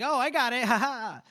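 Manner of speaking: high, loud sing-out with a laugh; carefree triumph. Retold or imitated. Soldier wading out for his boot, carefree triumph. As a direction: play a gleeful, oblivious soldier.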